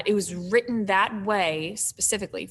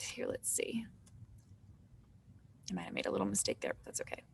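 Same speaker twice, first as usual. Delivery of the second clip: whispered aside; 2 semitones lower, 15 dB quieter, faster than usual. Real, not acted. Muttering to herself while highlighting.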